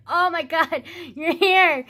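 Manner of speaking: high, happy retold cry; overjoyed relief. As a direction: relive being thrilled at an arrival. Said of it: "I was just so happy".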